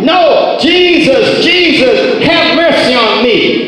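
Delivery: high, insistent cry for mercy; preacher acting out a desperate call; shouted plea. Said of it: "That's what he kept shouting".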